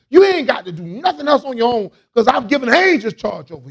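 loud, high preacher's cry; full-force preaching. Acted. Pastor to congregation, preaching at full force. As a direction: lift the room with it. Peak of the sermon.

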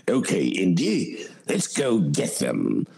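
low, slow, gruff movie sidekick; imitating a gravel-voiced movie sidekick; Indy sidekick voice. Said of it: "it was the big, you know".

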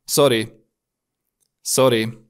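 "Sorry" like the name Sorin without the n; German-style sorry. Shown as a demonstration. Teacher modelling young Germans' word, "Sorry" said like Sorin minus n. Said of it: "ca și cum ați pronunța numele Sorin, fără N-ul de la sfârșit".

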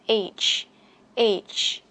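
"Age" with air bursting out on the final G; puff of air on ending. Shown as a demonstration. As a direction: push breath onto your palm at the end of "Age". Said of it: "sẽ thấy có hơi bật ra".

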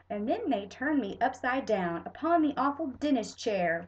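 ordinary delivery

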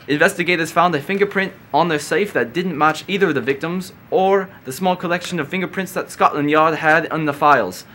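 hammed-up British accent on a news item; bad British accent. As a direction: try a clumsy British accent. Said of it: "I'll try to read this in a British accent".

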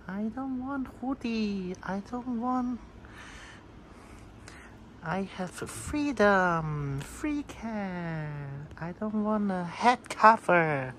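high, whiny cat voice; cat's voice. Acted. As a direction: owner voicing her cat, high and petulant. Speaks as the cat.

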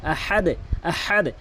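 slow আহাদ, with the final দ bounced downward; qalqala demo. Shown as a demonstration. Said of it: "নিচের দিকে ধাক্কা দিয়েছি".

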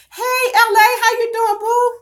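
high, loud sing-song hello to a viewer; excited greeting. Real, not acted. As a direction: call out a bright hello to the chat.

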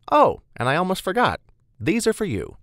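high, loud, chirpy burst; sudden remembering. Acted. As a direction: pipe up as if just remembering. Beth suddenly remembers the uniform.

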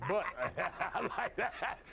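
laughing. Real, not acted. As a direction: laugh your way through the approval. Amused by ally's eagerness.

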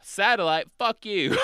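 loud, high-pitched mock scorn; pure confidence. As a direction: dismiss the idea with brash certainty. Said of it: "pure confidence".

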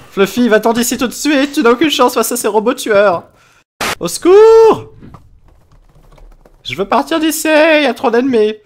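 mock terror at the robots; panicked pleading. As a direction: beg to escape, hammed-up panic. Fleeing killer robots.

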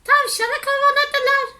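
loud, high, quick storytelling; high-pitched narration. A narrator's reading. Narration carried on in bee pitch.

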